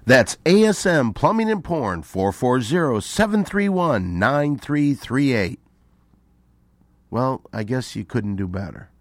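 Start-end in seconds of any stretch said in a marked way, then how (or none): 0.0-5.6 loud, high radio plug; punchy, emphatic announcer read. Real, not acted. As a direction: belt out the sponsor's phone number, drawing out the digits.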